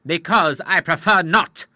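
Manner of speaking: curt and slow, each word set down; short. A narrator's reading. The Admiral cutting off the agent, curt and slow. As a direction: shut the matter firmly. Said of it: "said the Admiral shortly".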